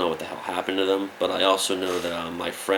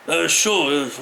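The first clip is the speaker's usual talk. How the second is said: a mock gruff "uh, sure", pitched up; 9 semitones higher, 4 dB louder than usual. Mock gruff [name] reply, higher.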